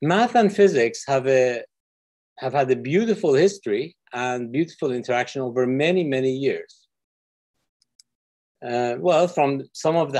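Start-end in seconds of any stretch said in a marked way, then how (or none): none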